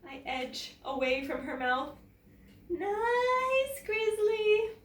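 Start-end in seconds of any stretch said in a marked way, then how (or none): 2.7-4.7 nervous coaxing. A narrator's reading. Edging away, nervous coaxing.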